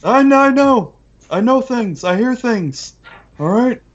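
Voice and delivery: high-pitched goblin voice; squeaky, insistent little crime boss; excitable goblin boss. Acted. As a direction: pitch it way up, indignant. Game master voices goblin boss.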